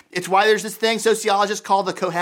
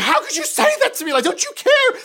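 much higher, louder, hurt mock wife; hammed-up wounded wife; mortified wife; 5 dB louder than usual. Pastor voices a wife hurt by her husband's advice, much higher and louder. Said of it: "becomes mortified".

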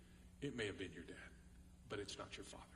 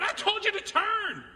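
scolding parent; 8 dB louder than usual. Retold or imitated. God voiced scolding the child.

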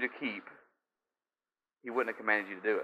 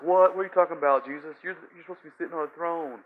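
high, startled what; mock alarmed disciples protesting; disciples' shock; 6 semitones higher, faster than usual. Disciples hearing Jesus will die, shocked. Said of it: "And they're like".

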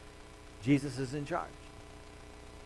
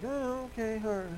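comic reluctance; 7 semitones higher, 5 dB quieter than usual. Acted. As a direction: agree meekly, as if you'd rather not. Simon reluctant about healing his mother-in-law.

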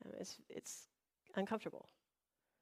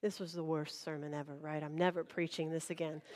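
low, quiet muttering to oneself, acted; imitated dejected aside; pastor's gloomy thought; 6 semitones lower, 7 dB quieter than usual. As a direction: grumble inwardly, low and soft. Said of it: "who's been thinking".

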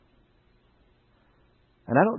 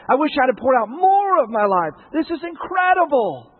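loud, rising preacher's cry; climbing pulpit fervour; full-force preaching; 6 semitones higher, 6 dB louder than usual. Acted. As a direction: preach it out with fire to the church.

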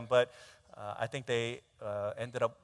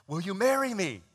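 lifted, louder eager ask; eager proposal; 6 semitones higher, 7 dB louder than usual. Retold or imitated. Groom down on one knee asking his girlfriend, eager. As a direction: pop the question eagerly.

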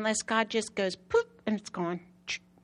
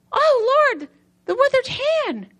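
much higher, louder cry of joy; ecstatic greeting; 11 dB louder, slower than usual. Retold or imitated. Preacher recalls greeting Jesus, ecstatic and loud. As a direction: call out to a dear friend in delight. Recognizing the Lord's reminder.

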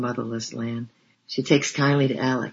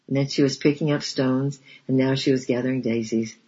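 plain reading voice

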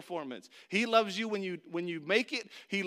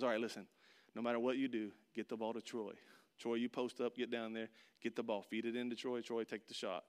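much lower, softer coach voice; a low hushed huddle instruction; coach's orders; 9 semitones lower, 9 dB quieter than usual. Retold or imitated. Coach in the final huddle, low and hushed. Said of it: "my coach says".

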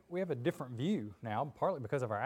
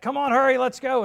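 high, very loud call to hurry; rally your friends to run; excited urging; 11 semitones higher, 13 dB louder than usual. Acted. Excited friends urging each other on.

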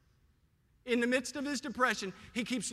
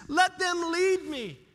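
high, slow, drawn-out appeal; pleading with God; 6 semitones higher, 4 dB louder, slower than usual. Acted. Psalmist asking God to lead him, slow pleading. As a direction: stretch the plea out toward heaven. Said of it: "Notice he pleads with God".

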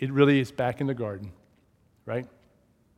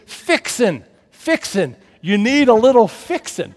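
higher, louder folksy twang on fixin'; Southern dad drawl; 7 semitones higher, 7 dB louder than usual. Retold or imitated. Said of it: "And he said".

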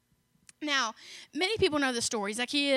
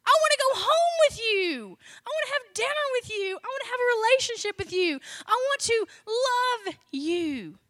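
much higher and louder, eager and bright with love; Jesus inviting himself home with the tax man, higher and louder; joyful invitation; 10 semitones higher, 5 dB louder than usual. Acted. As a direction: sing out the welcome to Zacchaeus. Jesus' loving invitation voiced.

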